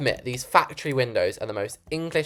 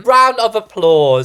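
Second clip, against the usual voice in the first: cheering; 8 semitones higher, 10 dB louder, slower than usual. Real, not acted. Celebrating the finished wall.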